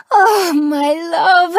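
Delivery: happy tears. Acted. Tears of happiness.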